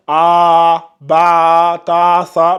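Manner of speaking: Ba, Ta, Sa called out strong and loud; loud letter recital. Shown as a demonstration. Teacher recalls old lesson, calling out Ba, Ta, Sa. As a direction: chant Ba, Ta, Sa loudly like a drill. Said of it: "on avait fait le cours".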